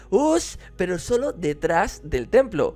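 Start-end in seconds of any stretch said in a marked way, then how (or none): 0.1-0.6 high, loud yelled us; kids' shout. Retold or imitated. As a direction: yell it like a cheeky kid. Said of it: "empezaron a gritar".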